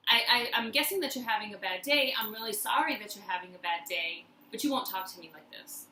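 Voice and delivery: ordinary talk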